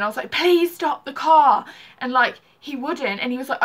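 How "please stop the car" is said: loud, slow, pleading cry to stop; begging the boyfriend driving recklessly, loud slow plea; retold scream. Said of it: "I was screaming".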